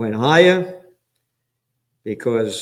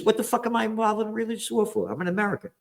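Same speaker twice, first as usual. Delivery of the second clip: rattled off high, fast and softer, mocking; mock attitude; 9 semitones higher, 5 dB quieter, faster than usual. Replaying his own outburst as a quick high mocking send-up. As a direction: toss the line off fast and high as a sneering send-up. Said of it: "Yeah, that's the attitude".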